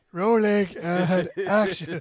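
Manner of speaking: slow laughing director call; impatient film director. Pretend. Calls a take like director.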